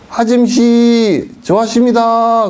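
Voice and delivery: slow, high, laughing flattery. Retold or imitated. Beggar flattering the housewife, slow laughing coax. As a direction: sweet-talk the lady with a laugh.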